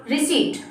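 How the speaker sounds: "रिसीट" with long ee and no p; correct receipt. Shown as a demonstration. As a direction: leave the p out of "रिसीट". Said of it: "क्या बोलेंगे?".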